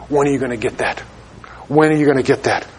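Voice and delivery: quiet plea. Real, not acted. Pleading question to congregation.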